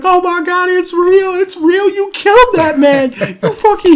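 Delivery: high, loud panicked shriek; mock panic. Friend running over to the fallen man, high-pitched mock panic.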